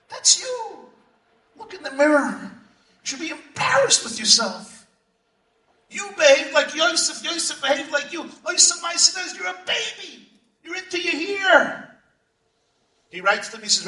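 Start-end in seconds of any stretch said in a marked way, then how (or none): 0.1-0.9 high, mocking jab; mocking teacher. Teacher shames the boy in class, high mocking jab. Teacher shaming a nine-year-old. 1.6-11.9 a higher-pitched mock of a scolding teacher; scornful teacher. Teacher scolds the nine-year-old about his hair, higher mock scorn. Teacher shaming the boy in class.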